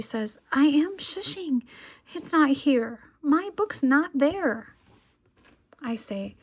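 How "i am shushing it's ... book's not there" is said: higher, louder protest, slowing at the end; too loud for a library; indignant child. Acted. Lola protesting loudly in library.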